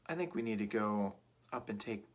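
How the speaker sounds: usual speaking voice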